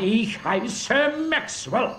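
slow, pointed insistence on the name; indignant insistence. Acted. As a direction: spell it out in irritation, slowly. Insists on his real name.

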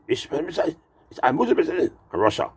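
high-pitched harsh fake German; rough mock German. Comic showing how rough German sounds, mock German accent. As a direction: spit out a rough German imitation. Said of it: "They say German too rough".

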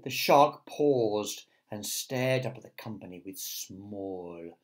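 plain reading voice

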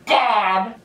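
high, repeated cries of disbelief; stunned shrieking. Real, not acted. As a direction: cry out, overwhelmed by what was just heard. Stunned by trailer line.

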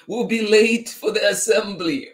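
high, loud teacher's call; hurrying teacher. A narrator's reading. Teacher rushing class to assembly, high urgent call. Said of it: "said the teacher".

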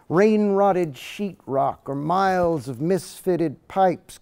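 lyrical poetry reading with rising momentum; poem reading. Acted. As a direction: give each image its weight, like a reading. Reads second poem aloud.

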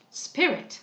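high exclamation with a falling end; exclaiming. A narrator's reading. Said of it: "cried Cecilia".

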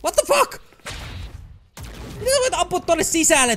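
can't believe what just happened; shocked shout. Real, not acted. Gamer shot through a wall, shouting in disbelief.

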